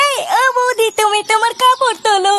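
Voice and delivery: sing-song doorstep call. Shown as a demonstration. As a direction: show off your technique for calling her out. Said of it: "এবার আমি কি করে ডাকি".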